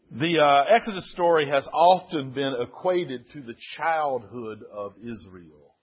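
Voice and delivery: ordinary talk, nothing marked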